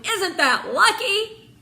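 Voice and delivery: delighted with herself; proud and pleased. A narrator's reading. Jen calling down proudly, pleased. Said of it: "called down proudly".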